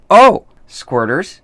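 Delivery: slow, loud and high reveal; drawn-out announcement. Acted. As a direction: announce it slowly for effect. Reading topic with drama.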